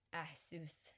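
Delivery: muttered softly under the breath; mumbled. Acted. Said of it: "she mumbled".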